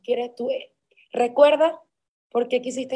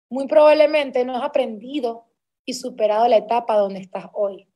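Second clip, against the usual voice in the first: quote read with emphasis; 7 dB louder than usual. Real, not acted. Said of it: "esto me encanta, esta frase".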